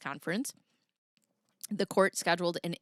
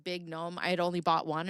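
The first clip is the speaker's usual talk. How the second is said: usual speaking voice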